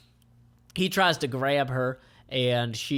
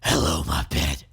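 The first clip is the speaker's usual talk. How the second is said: sinister greeting; 10 semitones lower, 4 dB louder than usual. Acted. Villain greets his captive.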